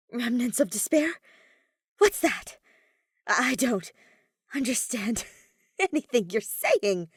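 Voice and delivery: put-on confused boy half laughing in disbelief; genuine confusion. A narrator's reading. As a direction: sound honestly lost and a little rattled. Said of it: "momentary, genuine confusion".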